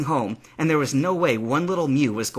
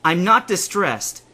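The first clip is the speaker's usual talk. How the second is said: slow, raised, emphatic denial; yelled denial; 7 semitones higher, 6 dB louder, slower than usual. A narrator's reading. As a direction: deny it loudly, drawn out. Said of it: "Would you stop yelling?".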